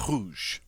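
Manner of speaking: Rouge with a forceful gargled R; guttural French R. Shown as a demonstration. Teacher repeats the word for red, Rouge with throaty R. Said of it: "but it's very forceful".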